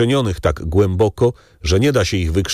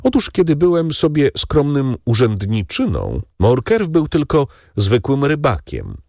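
higher, gloating baron voice; Danglars, gloating baron; 7 semitones higher than usual. Acted. Baron tells the count Morcerf was a fisherman, higher and gloating. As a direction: savour the fisherman detail.

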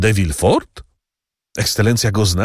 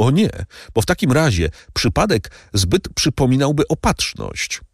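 much higher, urgent servant voice; rising dread in a high voice; fearful servant Bertuccio; 8 semitones higher than usual. A narrator's reading. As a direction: convince the count it is fate. Bertuccio's fatalistic plea.

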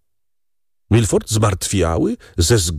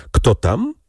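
ordinary delivery

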